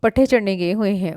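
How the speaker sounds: usual speaking voice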